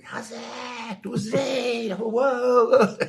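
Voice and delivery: high, shouting cheers on the TV; excited cheering. Retold or imitated. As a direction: whoop it up like you're on the parade float. Himself cheering on the TV.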